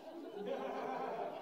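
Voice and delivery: a light, high, breathy laugh. Real, not acted. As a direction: laugh softly at yourself. Laughs at own reaction.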